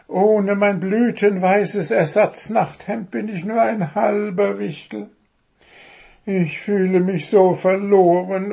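high, plaintive gnome voice; a lost little gnome lamenting; sorrowful little gnome. Acted. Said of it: "tiefer Wehmut".

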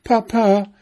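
put-on very high, loud child's cry; wailing call. A narrator's reading. Cubs running to their father, high wailing call. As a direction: wail it like bear cubs. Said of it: "riefen sie heulend".

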